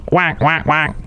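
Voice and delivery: a light quacking noise; duck quack. Acted. Host imitates a duck, a light quack. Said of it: "like an Affleck bird".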